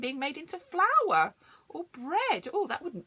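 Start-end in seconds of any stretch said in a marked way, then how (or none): none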